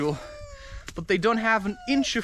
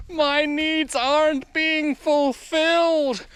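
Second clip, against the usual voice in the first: high, very slow theatrical wail; mock whining; 7 semitones higher, much slower than usual. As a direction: drag it out in a hammed-up complaint. Mocks people with unmet needs.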